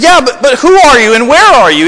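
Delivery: confused man on the cliff. Retold or imitated. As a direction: call out, lost and confused. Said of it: "And he says".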